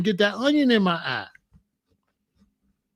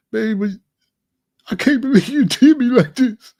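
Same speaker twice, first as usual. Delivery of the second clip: pleading, fake sobbing, voice much louder; fake crying; 2 semitones higher, 6 dB louder than usual. Pleading with girlfriend who wants to dump him, fake sobbing. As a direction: put on a fake sob to win her back. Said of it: "I'll fake cry".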